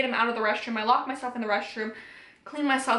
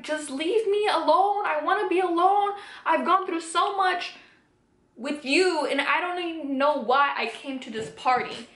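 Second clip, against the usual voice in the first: high-pitched emotional pleading, no real crying; retold distressed outburst; 5 semitones higher than usual. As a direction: act out an upset plea to be left alone. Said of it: "I uncontrollably started crying".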